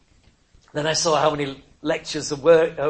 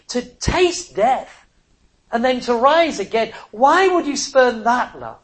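louder, slower, every word driven home; preaching at full force; 5 semitones higher, 5 dB louder, slower than usual. Real, not acted. Pleading with the congregation, a louder, slower preaching build.

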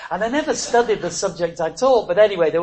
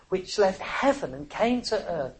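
ordinary delivery

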